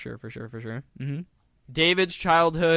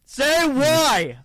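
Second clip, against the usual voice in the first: excited prompt; 10 semitones higher, 5 dB louder than usual. Acted. Prompting the joke punchline.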